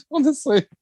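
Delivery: giggling at absurd news; can't stop laughing. Real, not acted. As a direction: talk through laughter.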